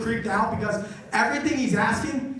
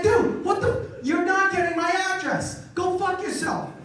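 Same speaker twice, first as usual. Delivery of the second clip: high-pitched indignant outburst; acted exasperated refusal; outraged customer; 8 semitones higher than usual. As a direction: push back in disbelief, voice jumping up.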